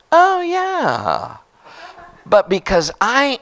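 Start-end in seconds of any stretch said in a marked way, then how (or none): 0.1-1.4 high drawn-out oh yeah; the penny drops; sudden realization. Retold or imitated. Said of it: "And I went".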